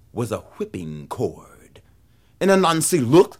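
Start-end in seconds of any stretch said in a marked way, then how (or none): none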